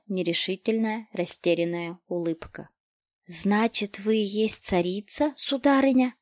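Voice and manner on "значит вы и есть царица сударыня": ordinary delivery, nothing marked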